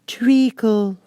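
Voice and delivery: high drowsy murmur; sleepy voice. A narrator's reading. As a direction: mumble it half asleep. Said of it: "said a sleepy voice behind her".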